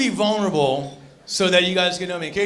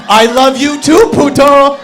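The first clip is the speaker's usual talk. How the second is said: loud, slow mock-sweet declaration; mock loving reply; 5 semitones higher, 12 dB louder, slower than usual. Comic yelling back at the leaving woman, loud mock tenderness. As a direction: belt it out like a fake love song.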